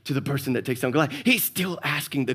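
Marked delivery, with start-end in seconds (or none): 0.0-1.1 hushed. Retold or imitated.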